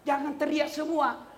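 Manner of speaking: usual speaking voice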